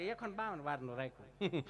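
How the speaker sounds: a soft, mumbling plot owner's reply; mumbled plot buyer. Retold or imitated. New plot owner answering the storyteller, in a soft mumble.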